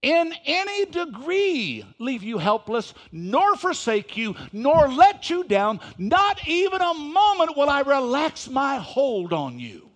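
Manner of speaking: loud, slow, emphatic verse reading; preaching scripture at full force. Acted. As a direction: drive each phrase home from the pulpit.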